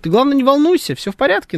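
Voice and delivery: high, soothing imitation of the boss; mock reassuring boss. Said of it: "говорит".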